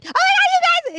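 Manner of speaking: very high, loud squeal; squealing excitement. Retold or imitated. Posting to friends about the documentary, high squeal. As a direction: shriek it to the internet. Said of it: "I'm like".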